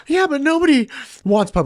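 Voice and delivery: high-pitched imitation of a panicking man; freaking out. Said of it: "the guy starts freaking out".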